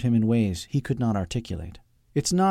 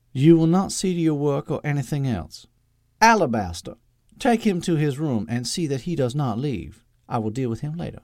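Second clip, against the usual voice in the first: imperious Southern mother; 5 semitones higher than usual. A narrator's reading. Mother ordering servant to lock son up, imperious. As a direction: lay down the law to the servant.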